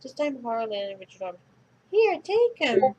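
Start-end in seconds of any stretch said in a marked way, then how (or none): 1.9-2.9 high, loud, slow panicked howl. Acted. Richard howls at friends to take the book. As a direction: yelp it while shoving the creature away. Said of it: "Richard howled".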